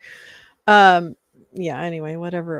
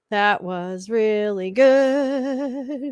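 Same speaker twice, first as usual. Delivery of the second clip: very slow, stretched-out delight; slow, lingering praise; drawn-out praise; 3 semitones higher, much slower than usual. Acted. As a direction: savour every word of it.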